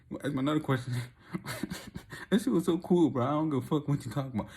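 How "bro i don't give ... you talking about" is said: normal talking voice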